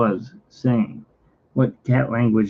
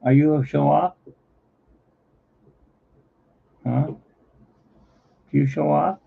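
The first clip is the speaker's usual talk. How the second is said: teasing the cat; 5 semitones higher, slower than usual. Real, not acted.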